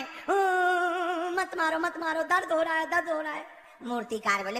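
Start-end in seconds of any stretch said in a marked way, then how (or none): 0.0-3.5 hammed-up wailing plea; mock crying. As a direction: moan "oh", then plead quickly as if crying. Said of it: "पत्थर लगा रोने". (रोने का नाटक करते हुए)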